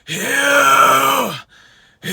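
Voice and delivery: raspy screamed word; mid scream. Shown as a demonstration. Coach trying a word with the technique, mid scream. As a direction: scream the word in the middle register. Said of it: "That's like a mid scream".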